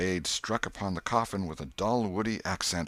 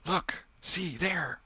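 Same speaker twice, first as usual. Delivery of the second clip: high, urgent scared pointing; frightened whisper; 12 semitones higher, much slower than usual. A narrator's reading. Tom shows Huck the lights, scared and urgent. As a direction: point it out, breathless with fear. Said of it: "whispered Tom".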